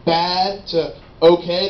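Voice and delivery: usual speaking voice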